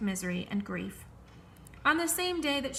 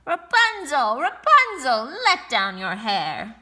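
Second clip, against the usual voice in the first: witch calling up; 3 semitones higher, 9 dB louder, slower than usual. A narrator's reading. Sorceress calling to Rapunzel while the prince watches, loud. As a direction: cry up the tower, loud and drawn. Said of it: "heard her call out".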